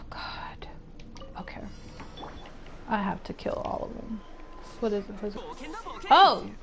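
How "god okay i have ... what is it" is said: hushed, whispered resolve; whispering to self. Real, not acted. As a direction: whisper it softly to yourself. Psyching self up, to self.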